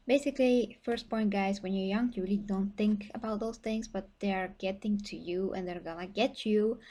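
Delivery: usual speaking voice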